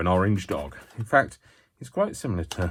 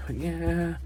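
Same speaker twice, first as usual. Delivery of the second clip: playful pretend masseuse; 5 semitones higher, 5 dB louder than usual. Artist pretending to massage the leather, mock masseuse. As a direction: play at giving a soothing massage, hammed-up.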